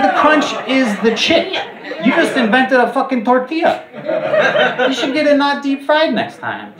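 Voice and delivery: telling the ex she invented a tortilla, high and loud; exasperated disbelief. Retold or imitated. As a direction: let the disbelief crack your voice up high. Retold exasperated reply to ex.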